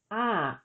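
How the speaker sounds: ah with a falling Thai tone. Shown as a demonstration. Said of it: "the tone is falling, as in".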